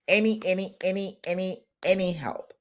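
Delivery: ANY repeated in separate pairs with pauses; insistent repetition. Real, not acted. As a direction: punch out each "any", pausing between pairs. Insists on offering any help.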